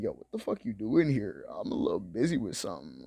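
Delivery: lightly voiced annoyed landlord brushing her off; grumpy landlord.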